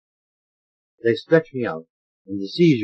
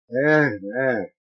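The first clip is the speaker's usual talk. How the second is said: plain reading voice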